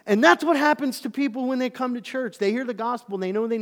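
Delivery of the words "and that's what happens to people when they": loud, high, fervent preaching; preaching at full force. Acted. Preacher to church, crying out the inner fight loudly. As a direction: lift the whole room with it.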